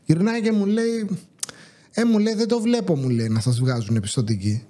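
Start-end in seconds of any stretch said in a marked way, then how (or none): 1.9-4.6 mock dismissive clerk, pitch sinking low at the end; dismissive attitude. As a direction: imitate a snooty, scornful bank clerk. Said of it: "με ένα υφάκι τώρα τελ-, τελείως απαξιωτικό".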